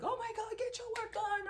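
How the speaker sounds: high, loud mock yell at the kids. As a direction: yell it up high like a frazzled mom. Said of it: "and I'm, like, yelling".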